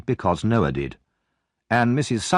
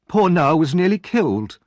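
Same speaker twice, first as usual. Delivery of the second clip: put-on high, sniffy young woman; disdainful maid; 8 semitones higher, 4 dB louder than usual. Charlotte complains to Missus Sowerby about workhouse boys, high and sniffy. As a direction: look down your nose at workhouse boys.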